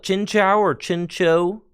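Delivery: first Chinchou ends like "chow", second Chinchou like "cho"; contrasting pronunciations. Shown as a demonstration. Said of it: "You call it".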